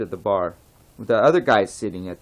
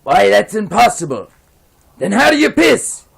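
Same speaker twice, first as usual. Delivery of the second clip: higher and louder, rising in disbelief; incredulous bartender; 9 semitones higher, 9 dB louder than usual. Acted. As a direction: blurt it out, flabbergasted. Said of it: "And the bartender says".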